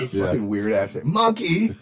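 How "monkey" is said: high drawn-out Monkey; imitating the character, higher and louder; mock call. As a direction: sing out the name in a silly high voice.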